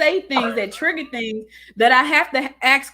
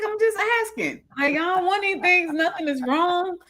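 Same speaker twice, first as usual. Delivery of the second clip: higher, innocent protest in a put-on voice; defensive innocence; 9 semitones higher than usual. Wife insists to suspicious husband she wants nothing, mock innocent. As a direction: play it all innocent, nothing to see here. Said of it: "And I'm like".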